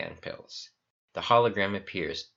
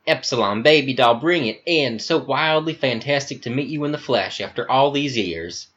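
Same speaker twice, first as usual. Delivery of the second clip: higher, louder, gushing Southern charm; flamboyant Southern accent; 5 semitones higher, 4 dB louder than usual. Acted. Talk Now hugging Epsilon, gushing Southern accent. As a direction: greet him like a long lost darling.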